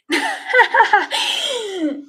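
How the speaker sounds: slow, giggling plea; laughing. Real, not acted. Embarrassed about the hole in her sock, laughing.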